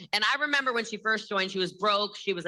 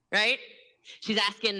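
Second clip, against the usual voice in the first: ordinary talk, nothing marked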